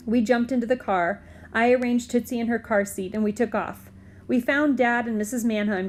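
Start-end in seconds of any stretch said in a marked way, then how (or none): none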